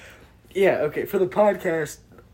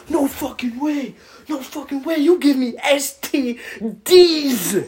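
high-pitched, emphatic refusal building louder; mock scream; 10 semitones higher, 5 dB louder than usual. Imitating a friend refusing the threesome, screamed with power and enunciation. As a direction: punch each word, stretching out the final letters. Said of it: "that amount of power and enunciation".

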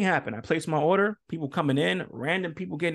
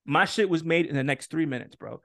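ordinary talk, nothing marked

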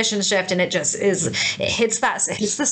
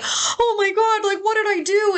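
mock panic, pitched much higher; panicked partner; 12 semitones higher than usual. Wife imitating the panicked husband he never was, high mock alarm. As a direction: imitate a frightened partner blaming himself. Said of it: "you weren't like".

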